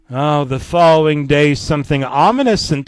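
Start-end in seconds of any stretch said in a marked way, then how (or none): none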